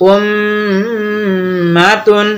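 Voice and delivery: উম্মাতুন with the ম doubled, long and nasal; nasal ghunna. Shown as a demonstration. Teacher demonstrating ghunna on উম্মাতুন, slow nasal. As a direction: stretch the মম of উম্মাতুন through the nose. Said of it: "নাকে আওয়াজ নিয়ে পড়বো".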